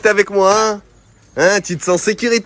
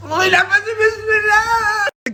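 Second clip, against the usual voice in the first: excited cry; 10 semitones higher, slower than usual. Real, not acted. Sudden crying shout, much higher.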